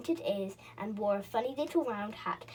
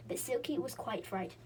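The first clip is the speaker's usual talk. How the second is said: plain reading voice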